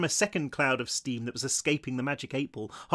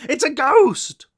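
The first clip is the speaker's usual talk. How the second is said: high, loud, drawn-out alarm; frightened scream; 12 semitones higher, 11 dB louder, slower than usual. A narrator's reading. Buster falling back from the ghost, frightened scream. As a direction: cry out slowly in terror. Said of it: "Buster screamed, falling back".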